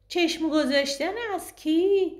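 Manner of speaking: put-on high, bright little girl's voice; a small girl calling the counting game; child voice. A narrator's reading. Young girl proposing the counting game, in a high child's voice.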